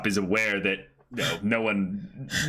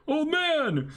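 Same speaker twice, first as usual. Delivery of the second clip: calling out. Acted. Calling to the old man.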